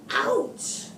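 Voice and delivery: sharp breathy ouch, high; mock ouch. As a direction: yelp as if stung. Reading bee sting picture book.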